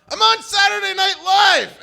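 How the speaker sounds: high furious shout; screamed. Retold or imitated. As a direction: scream it at her, wounded pride. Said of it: "and I screamed at her".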